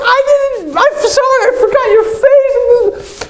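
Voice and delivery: high, squeaky panicked apologizing; flustered apology. Retold or imitated. Awkward guy apologizing to the girl, high and panicked.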